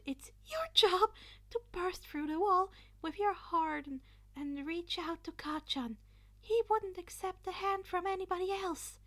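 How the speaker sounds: high, earnest urgency; urgent. Acted. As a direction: plead it with conviction. Izuku continues urgent orders.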